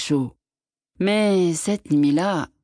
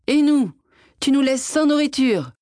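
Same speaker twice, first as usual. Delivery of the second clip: angry scolding; 9 semitones higher than usual. A narrator's reading. Wife scolds husband, no food.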